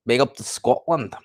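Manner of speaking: badly faked Scottish accent; attempted Scottish accent. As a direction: try a Scottish accent and not quite get it. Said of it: "I can't do the Scottish accent".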